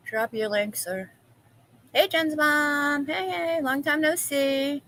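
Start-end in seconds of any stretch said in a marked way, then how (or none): 1.9-4.8 drawn-out greeting. Real, not acted.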